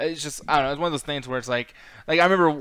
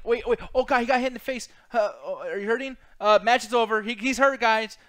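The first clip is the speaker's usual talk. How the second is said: mock panicky referee, raised pitch; fussy referee; 6 semitones higher, 4 dB louder than usual. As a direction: fret like an overcautious referee. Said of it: "referees are being so careful".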